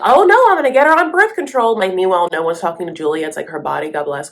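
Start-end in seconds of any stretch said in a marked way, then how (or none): 0.0-1.8 high, loud mock-panicked mother; panicked mom impression. Recapping the show, Betty panics about birth control, high and loud. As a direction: shriek it like a frantic mom. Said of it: "Betty's like".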